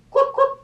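a very high "Cluck, cluck"; hen clucking. Acted. Hen answering Duck with high clucks. Animal noises in picture book.

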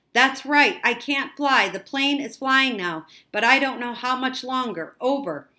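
high, loud, anxious radio voice; urgent distress reply. A narrator's reading. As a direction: answer urgently over the static. Frightened boy confirms by radio.

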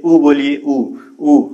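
drawn-out rounded ऊ with lips pushed round; vowel demo. Shown as a demonstration. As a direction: hold ऊ long, lips rounded. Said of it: "ओठ क्या हो जा रहा है".

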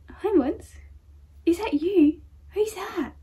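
high, sing-song pet-talk voice; talking to dog. Real, not acted. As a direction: coo excitedly at your puppy. Dog sees himself on camera.

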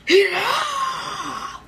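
high, slow yelled cheer; slow drawn-out shout. Real, not acted. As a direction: drag out the shout to egg him on. Egging friend on to eat.

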